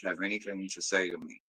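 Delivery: ordinary talk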